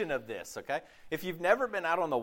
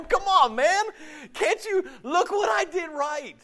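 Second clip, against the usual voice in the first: mock whiny complaint; 14 semitones higher, slower than usual. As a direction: play the hurt disciple fishing for praise. Whining back at Jesus.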